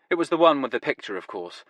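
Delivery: usual speaking voice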